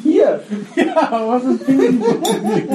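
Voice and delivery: high, giggling question; laughing. Real, not acted. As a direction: ask it while cracking up. Laughing over the silly name.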